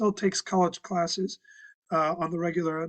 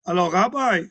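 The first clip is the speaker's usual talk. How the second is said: put-on Israeli accent; 6 dB louder than usual. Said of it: "she still has that beautiful Israeli accent".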